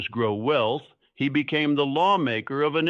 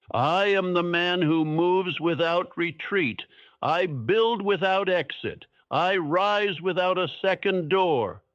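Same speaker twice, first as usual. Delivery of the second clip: higher, resolute declaration, each line landed firmly; declaration with finality; 4 semitones higher than usual. Shown as a demonstration. Speaker models a vow for listeners to repeat, resolute and final. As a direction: declare it like there is no way back. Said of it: "Say it with finality".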